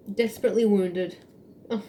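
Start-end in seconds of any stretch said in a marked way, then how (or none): none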